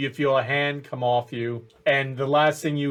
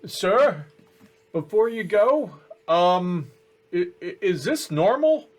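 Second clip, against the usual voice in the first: higher, hesitant nervous guard; 4 semitones higher than usual. Acted. Guard asking his superior, a nervous higher stammer. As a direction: stammer it out like an unsure young guard.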